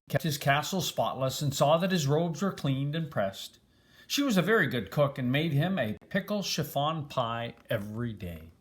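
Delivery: usual speaking voice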